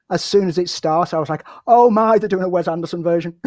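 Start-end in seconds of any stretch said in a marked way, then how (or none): 1.7-3.4 loud, high burst of retold delight; delighted reaction. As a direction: relive the thrill of spotting it, higher and louder at the start. Retelling own delighted first reaction.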